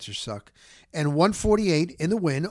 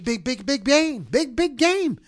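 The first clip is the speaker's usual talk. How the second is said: pitched up, hammering each big; big game hype; 7 semitones higher than usual. Real, not acted. Host hyping the matchup to listeners, pitched-up excitement. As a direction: sell it as a huge game.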